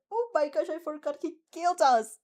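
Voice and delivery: high, loud sudden realisation; excited outburst. Real, not acted. Streamer reacts to the fanfic with excitement. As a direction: blurt it out in delight.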